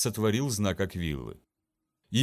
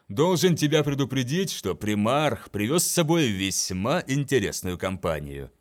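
ordinary delivery

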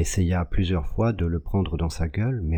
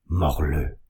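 lower, firm repeat of the command; repeating the order; 3 semitones lower than usual. A narrator's reading. Jacques repeats his order to the dog, low and firm. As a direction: say it again, low and steady. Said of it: "Il répéta".

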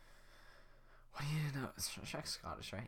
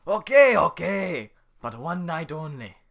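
gruff Shrek; 7 semitones higher, 17 dB louder, slower than usual. Acted. Shrek giving in, loud.

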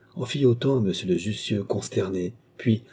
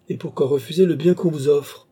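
higher-pitched bitter interruption; sour; 7 semitones higher, 4 dB louder than usual. A narrator's reading. Rousseau interrupting Gilbert's refusal, with sour bitterness. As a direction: cut in with a sour question. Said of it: "interrompit Rousseau avec aigreur".

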